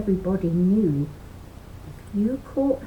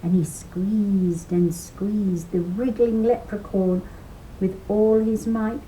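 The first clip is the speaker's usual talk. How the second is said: ordinary delivery, nothing marked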